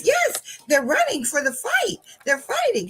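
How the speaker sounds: high, eager retold announcement; excited confirmation. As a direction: confirm the big news excitedly. Excitedly confirming fight to student.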